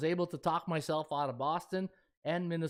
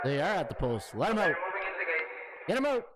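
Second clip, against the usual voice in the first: race caller. Pretend. Host starting the race for his viewers, calling it out like an announcer. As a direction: call them out of the gate like a track announcer.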